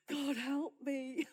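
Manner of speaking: mock desperate plea. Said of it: "we're like".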